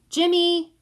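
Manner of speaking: high, loud holler of a name. A narrator's reading. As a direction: holler across the house. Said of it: "Teresa hollers".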